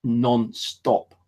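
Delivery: usual speaking voice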